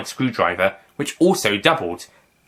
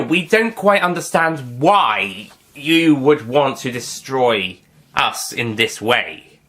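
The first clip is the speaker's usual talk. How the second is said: usual speaking voice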